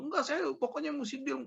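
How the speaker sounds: high-pitched, insistent imitation of a teller; pushy salesperson. Teller pressing a customer to close a deal, pushy. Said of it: "kayak orang marketing mau".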